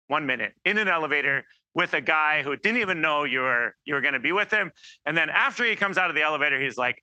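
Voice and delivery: normal talking voice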